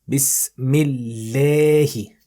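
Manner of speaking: Bismillahi very slow, a stretched wide; mouth stretched sideways. Shown as a demonstration. Teacher models the word for students, very slow. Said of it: "Stretch your mouth sideways".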